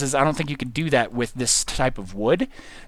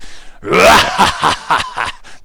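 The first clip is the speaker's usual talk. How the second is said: loud, forced, breathy cackle; bad laugh imitation; 5 dB louder than usual. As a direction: badly fake a maniacal laugh. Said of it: "terrible imitation of my previous laughter".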